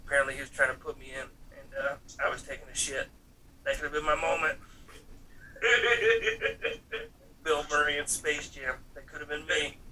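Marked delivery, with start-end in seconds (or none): 5.6-7.0 bright rising laugh; high laugh. Real, not acted. A laugh at his own missed moment. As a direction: chuckle at yourself.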